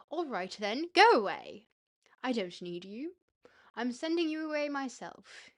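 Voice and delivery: put-on defiant child sending mother away, pitch jumping. Boy's imagined words to his absent mother, defiant. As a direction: send them off defiantly, punching "go away" loudly. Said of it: "a defiant meaning".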